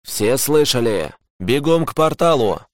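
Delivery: rally the squad to run; urgent order. Acted. (срочная команда, чуть приподнятым голосом)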